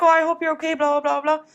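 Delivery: put-on concerned customer voice; mock sympathy. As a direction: imitate over-sympathetic customers, running into blahs. Mimicking the sympathetic, fooled customers.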